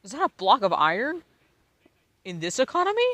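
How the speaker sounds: high loud disbelief; shocked. Real, not acted. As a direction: shout in shock. Shocked exclamation.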